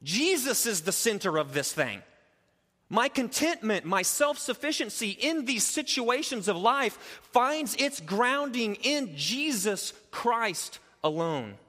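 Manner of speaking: high, loud pulpit preaching, driving each phrase; full-force preaching. Acted. Pastor preaching verse thirteen to the church at full force. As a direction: preach it out to the congregation with full conviction.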